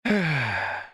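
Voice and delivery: long audible sigh. Real, not acted. Sighing before continuing after the missing piece, long sigh. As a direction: let out a long breath.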